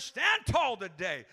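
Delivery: ordinary talk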